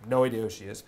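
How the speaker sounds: ordinary talk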